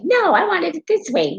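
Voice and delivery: put-on high, pleading child's voice; whiny child. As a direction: play the sulky kid who wants it his way. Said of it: "put myself in the eyes of a child".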